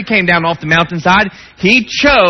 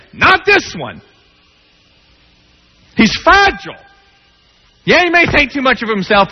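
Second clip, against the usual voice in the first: impassioned preaching; 9 semitones higher than usual. Acted. Preacher challenging God over Peter, impassioned and pleading. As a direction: press the questions like an aggrieved friend.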